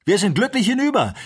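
high, loud cry of triumph; jubilant shout. A narrator's reading. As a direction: call it out in relief and victory. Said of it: "rief jetzt eine Stimme".

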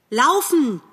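high, drawn-out urgent call; called-out warning. A narrator's reading. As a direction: call out a warning while racing by. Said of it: "rief er, da er Bambi erblickte". (hoher, warnender Ruf)

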